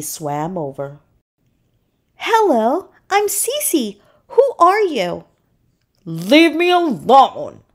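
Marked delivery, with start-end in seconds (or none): 2.2-5.2 high-pitched little seahorse voice; friendly seahorse. A narrator's reading. Seahorse introduces herself to Puffy. 6.0-7.6 cross storybook grumble, pitched up; grumbling. A narrator's reading. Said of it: "Puffy grumbled".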